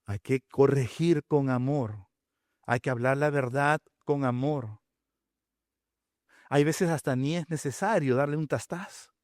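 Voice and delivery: usual speaking voice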